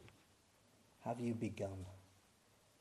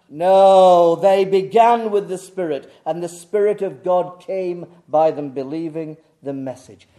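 loud, insistent pulpit rebuttal; full-force preaching; 3 semitones higher, 16 dB louder than usual. Real, not acted. Preacher rebutting the teaching of works, loud full-force preaching. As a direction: burst in loudly on "No" and drive on.

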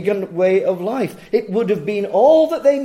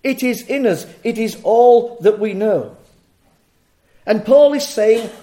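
usual speaking voice

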